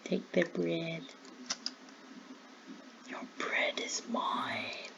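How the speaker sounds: low, slow whisper of a greedy thief; villainous whisper. Pretend. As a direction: breathe it like a sneaky villain. Stealing villagers' bread.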